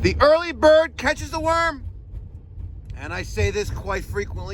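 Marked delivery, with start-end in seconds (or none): 0.0-1.9 high, lightly hammed-up proclamation; proclaiming motto. To his viewers, preaching his motto in a mock-grand voice. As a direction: announce the proverb like a sage.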